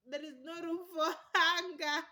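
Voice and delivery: loud laughing burst on hunger; laughing exclamation. Real, not acted. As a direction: laugh the line out. Repeated line, laughing take.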